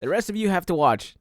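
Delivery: high, loud cheery announcer voice; mock company announcement. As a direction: play a smug host announcing. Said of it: "being like".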